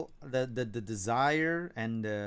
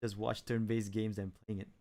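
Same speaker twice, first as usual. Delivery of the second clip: usual speaking voice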